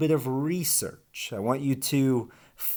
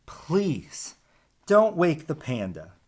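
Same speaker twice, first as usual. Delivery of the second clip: urgent warning; 7 semitones higher, 4 dB louder than usual. Acted. Warning grows more urgent.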